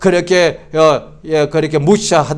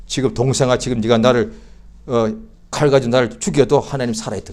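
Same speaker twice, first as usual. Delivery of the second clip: brother's calm reply; 6 semitones lower, 5 dB quieter than usual. Retold or imitated. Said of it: "제 눈을 딱 쳐다보면서".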